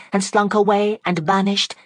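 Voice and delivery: ordinary delivery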